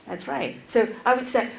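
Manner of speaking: ordinary delivery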